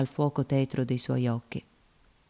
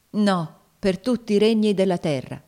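raised, louder, resolute no; firm refusal; 5 semitones higher, 5 dB louder than usual. A narrator's reading. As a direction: refuse with firmness. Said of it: "rispose Tom con fermezza".

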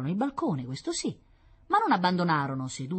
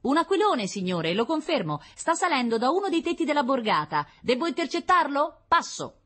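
high, loud reporting voice; scout's radio report; 12 semitones higher, 5 dB louder than usual. Acted. Scout reporting a kite to the general, high and loud. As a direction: announce the sighting in a high voice.

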